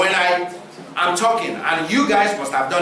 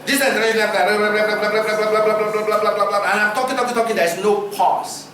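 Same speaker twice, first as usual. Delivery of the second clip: rushed nonstop talking; 3 semitones higher than usual. Shown as a demonstration. Preacher demonstrating talk without pauses, rushed nonstop. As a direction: gabble on with no pauses, blah blah. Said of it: "Imagine if I'm talking like this".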